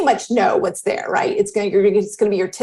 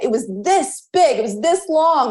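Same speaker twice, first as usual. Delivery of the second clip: much higher, awed boasting; excited kid boast; 5 semitones higher than usual. Retold or imitated. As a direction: show off the size, thrilled. Daughter's boasting about size.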